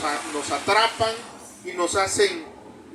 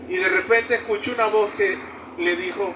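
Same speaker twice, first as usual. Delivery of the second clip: ordinary delivery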